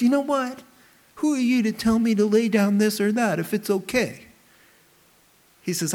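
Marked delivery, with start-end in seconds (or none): 0.0-4.3 high, defiant inner voice; that little voice. Pretend. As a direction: sneer back like a rebellious inner self. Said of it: "I give myself that voice that says".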